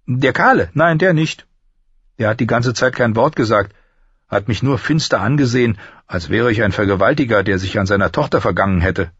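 high-voiced lad grumbling about a lord; apprentice boy, outspoken. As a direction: blurt it out as the young apprentice.

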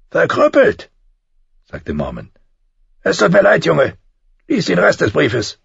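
grave commander. A narrator's reading. Mormont tells John Bran is crippled, blunt then sorry. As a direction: deliver it hard, then soften. Mormont delivers bad news.

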